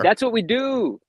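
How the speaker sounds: proud and loud. Real, not acted. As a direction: announce it proudly. Proud answer about eBay bidding.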